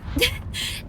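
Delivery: short, high burst of laughter; amused laugh. Real, not acted. Gamer tickled by an old game comparison, amused laugh. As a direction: let out a quick high giggle.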